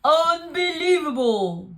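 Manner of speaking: loud exasperated bear voice; grumbling big bear disbelief; rumbling. Acted. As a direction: bear finding the mouse again. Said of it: "rumbled the bear".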